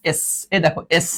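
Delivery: usual speaking voice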